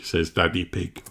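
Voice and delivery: plain reading voice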